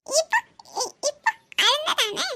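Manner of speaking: put-on high stumbling little girl voice; child voice. Niece explaining dust in her eye, high childish. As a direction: stammer like a small child.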